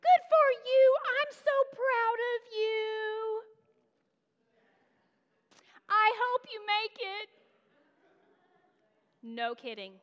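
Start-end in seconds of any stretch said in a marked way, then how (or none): 0.0-7.3 high, loud, slow fake-sweet praise; mock congratulation. Snobby classmate feigning pride in her, loud sugary condescension. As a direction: gush falsely proud. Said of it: "And she goes".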